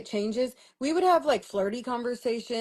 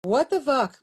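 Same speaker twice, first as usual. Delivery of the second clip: higher, louder angry repeat of the swear; retold yell at the boyfriend, higher and louder; outraged yell; 5 semitones higher, 6 dB louder, slower than usual. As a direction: blurt it out in disbelief on the phone. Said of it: "And I'm like".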